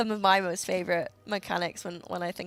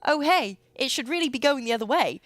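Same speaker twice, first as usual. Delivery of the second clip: higher, louder, brisk chiming in; clueless helpful pug; 5 semitones higher, 4 dB louder than usual. Acted. Random player chimes in about the rune, brightly oblivious. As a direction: butt in with a helpful correction.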